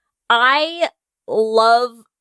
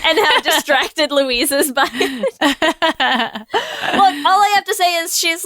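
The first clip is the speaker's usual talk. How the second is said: words broken up by giggles; laughing while talking; 4 semitones higher, slower than usual. Real, not acted. Describing distracted Louise to friends, laughing. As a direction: talk through the laughter.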